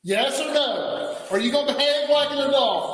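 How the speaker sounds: officer shouting a yes or no ultimatum; tough shout. Real, not acted. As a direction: hammer each word like a drill sergeant. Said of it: "he sounded tough".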